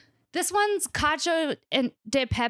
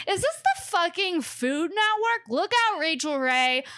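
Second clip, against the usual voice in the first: mock excited; 6 semitones higher than usual. Joking she rivals a TV chef, hammed-up excitement. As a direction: play the hammed-up cooking show host. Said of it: "I'm giving a tour".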